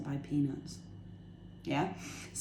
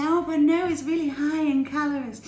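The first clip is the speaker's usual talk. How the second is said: high, loud whiny objector voice; mocking naysayer; 8 semitones higher, 7 dB louder than usual. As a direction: mimic a fussy calorie counter. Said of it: "everyone's gonna say".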